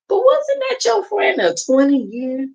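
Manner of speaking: high incredulous question. Real, not acted. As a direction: ask it like you can't believe it.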